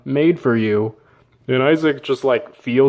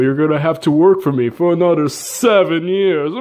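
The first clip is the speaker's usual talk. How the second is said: higher, smug scheming villain voice; mustache-twirling villain; 3 semitones higher than usual. Retold or imitated. Laban smugly springing the trick on Jacob, cartoon villain. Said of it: "While he twirls his mustache".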